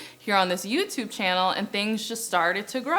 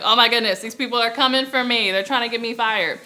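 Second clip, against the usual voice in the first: mock panic; 4 semitones higher, 6 dB louder than usual. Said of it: "I was like".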